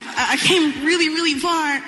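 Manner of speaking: tearful and overwhelmed; crying through the thanks; choked up crying. Real, not acted. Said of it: "I'm not an attractive crier".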